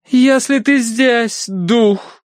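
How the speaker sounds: high, loud, drawn-out irritation; явное раздражение. A narrator's reading. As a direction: address the spirit with obvious impatience. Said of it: "с явным раздражением". (раздражённо, высоко и протяжно)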